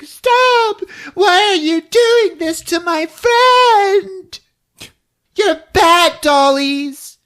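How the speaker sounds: put-on high, loud, wailing child's plea; mock crying girl. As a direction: cry out like an upset little girl. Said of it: "she stops him by crying".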